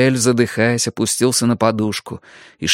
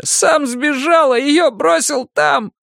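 put-on high, slow, sobbing young man; slower than usual. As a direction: keep weeping through it. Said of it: "зарыдал юноша".

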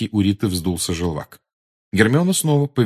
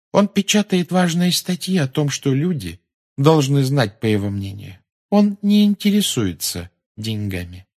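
higher voice, slowing as it goes; earnest daughter; 6 semitones higher than usual. A narrator's reading. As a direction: defend your father in all sincerity. Luna earnestly defends her father's paper.

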